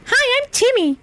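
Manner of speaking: put-on very high, bright child voice; little boy. Shown as a demonstration. A small boy meeting someone new, chirpy child voice. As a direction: introduce yourself like a small kid. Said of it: "a little boy".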